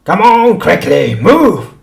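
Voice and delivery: high, loud driving yell; furious showman forcing him to walk; angry shouting. A narrator's reading. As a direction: bark him across the room. Shopkeeper drives the creature.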